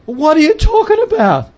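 high-pitched mock disbelief; baffled protest. Accused missionary answering the villagers, high baffled protest. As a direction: act out an incredulous reply. Retold bewildered reply to accusation.

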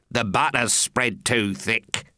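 lightly voiced low grumble; a hint of a dour carpenter; blunt carpenter. Carpenter complains about the butter, mildly grumbling. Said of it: "The carpenter said nothing but".

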